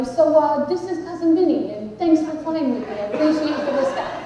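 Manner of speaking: New Jersey tough-guy captain; New Jersey pilot. Acted. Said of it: "somebody from New Jersey saying".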